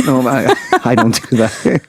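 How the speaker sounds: usual speaking voice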